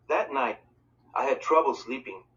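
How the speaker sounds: ordinary delivery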